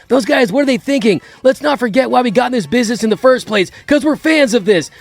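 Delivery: mocking rant. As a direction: lecture the media, half-mocking. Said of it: "I'd be like".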